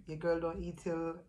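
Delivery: usual speaking voice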